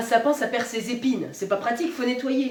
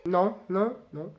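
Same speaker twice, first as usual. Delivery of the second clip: ordinary talk, nothing marked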